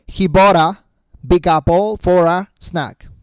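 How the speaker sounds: very slow, "bought a" linked with a flapped r sound; exaggerated slow linking. Shown as a demonstration. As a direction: stretch out every linked word. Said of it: "claro que lo estoy exagerando". (muy lento, exagerado, con "bought a" unido)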